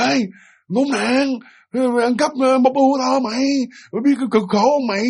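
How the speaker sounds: high-pitched acted telling-off of a thief; indignant scolding. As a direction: call out high, scolding someone across the field. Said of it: "bà tay bà chống lạnh, bà kêu". (giọng cao, chửi mắng kẻ trộm)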